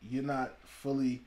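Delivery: normal talking voice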